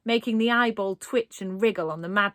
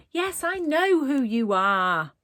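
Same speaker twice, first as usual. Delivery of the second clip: exasperated; 6 semitones higher, 4 dB louder, slower than usual. A narrator's reading. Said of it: "exasperated now".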